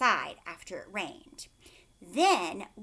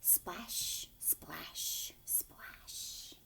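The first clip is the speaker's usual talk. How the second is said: whispered, getting softer each time; fading whisper; 4 semitones lower, 11 dB quieter, much slower than usual. Acted. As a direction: let each splash die away. Sound words fading away.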